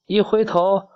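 usual speaking voice

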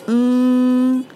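嗯 held high and loud as ng; long steady back nasal; open-mouthed ng. Shown as a demonstration. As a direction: hum 嗯 with mouth open, tongue down. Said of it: "张嘴".

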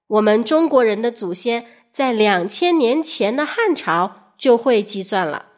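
ordinary delivery

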